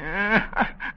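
a pleased little cackle; old man's chuckle. Acted. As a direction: chuckle at having known all along. Old man gloats at knowing.

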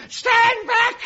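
hammed-up melodrama yell; villain shout. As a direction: bark the threat. Villain threatens with a knife.

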